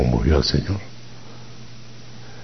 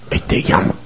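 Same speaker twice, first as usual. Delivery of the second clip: hushed message; 5 dB quieter, faster than usual. Acted. Martha passes message to Mary quietly.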